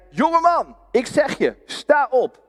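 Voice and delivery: high, ringing call; commanding Jesus. Acted. Jesus commanding the dead young man to rise, ringing. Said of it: "En hij zei".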